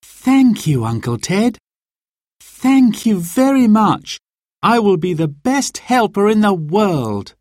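put-on high, gushing thanks from a thrilled girl; girl thanking her uncle, overjoyed; delighted. A narrator's reading. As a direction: bubble over with gratitude. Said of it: "Mary was delighted".

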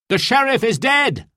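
very high, urgent cry; excited announcement. A narrator's reading. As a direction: cry out the dramatic news. Robin calls news to Marion.

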